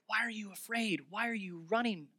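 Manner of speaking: softly pleading questions; hushed, gentle challenge. Acted. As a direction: ask it softly, with tender insistence. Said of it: "gentle voice".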